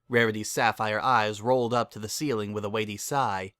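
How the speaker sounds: ordinary delivery, nothing marked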